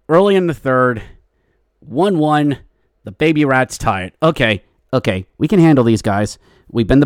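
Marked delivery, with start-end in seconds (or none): none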